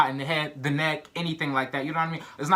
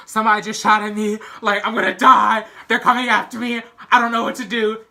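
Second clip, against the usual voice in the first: higher and much louder, frantic panic; panicked; 6 semitones higher, 8 dB louder than usual. Retold or imitated. Telling mom she was just shot at, frantic and loud. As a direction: blurt it out terrified, like your life's in danger. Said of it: "I'm panicking".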